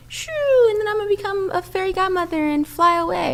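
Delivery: high-pitched, quick make-believe flight; airy send-up of magical healing; mock fairy-tale breeziness. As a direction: whoosh off like a pretend fairy godmother. Mocks idea of instant magical healing.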